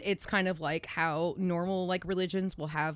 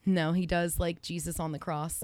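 ordinary talk, nothing marked

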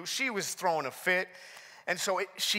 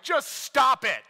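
loud, high, slow mock scolding; exasperated dad; 6 semitones higher, 6 dB louder than usual. Dad snapping at whining daughter in the van, mock exasperation. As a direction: act out a dad losing patience. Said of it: "me being the dad".

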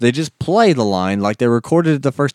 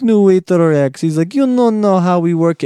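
higher, louder mocking voice; smug rival waiter; 8 semitones higher, 4 dB louder than usual. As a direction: sneer it at the new guy.

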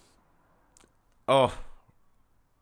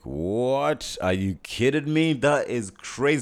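retold disbelief; 5 semitones higher, 5 dB louder, much slower than usual.

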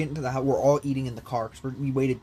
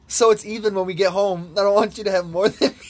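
louder, higher mock justification; raised, high-pitched retold excuse; defensive girlfriend; 6 semitones higher, 6 dB louder than usual. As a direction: imitate a girlfriend defending herself.

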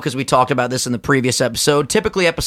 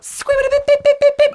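ordinary talk

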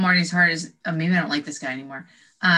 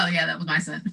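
normal talking voice

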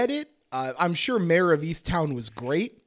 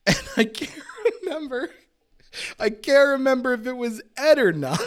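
laughing; 8 semitones higher, 4 dB louder than usual. Real, not acted. Laughing while checking facts.

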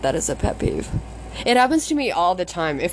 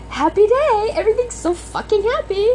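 sarcastically over-happy, pitched way up; Mister Rogers; 13 semitones higher than usual. Acted. Venting about forced cheer, mock-cheerful children's host. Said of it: "I feel like fucking Mister Rogers lately".

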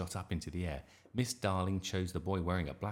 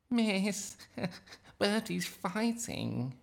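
high, drawn-out tattletale whine; whining; 5 dB louder, much slower than usual. A narrator's reading. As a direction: whine to the teacher, loud and high. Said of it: "whined Nick".

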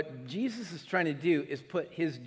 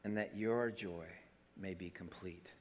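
softer, lower quiet reading; hushed scripture; 10 semitones lower, 11 dB quieter than usual. Shown as a demonstration. Preacher softly rereading the promise of joy. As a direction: let the verse fall to a hush. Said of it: "And then he says".

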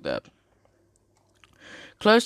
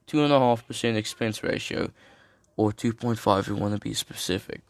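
normal talking voice

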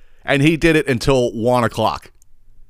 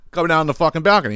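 usual speaking voice

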